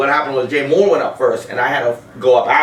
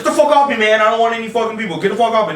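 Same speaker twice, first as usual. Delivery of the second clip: retold yell; 7 semitones higher, faster than usual. Dane Cook brushing off a fan, retold as a mock yell. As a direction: bark him off like an annoyed star. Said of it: "he yelled at me".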